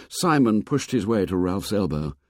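plain reading voice

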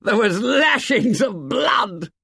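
high, loud laughing shudder. A narrator's reading. Jack laughing and shuddering telling of the blood. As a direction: laugh it out with a shiver. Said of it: "laughing and shuddering".

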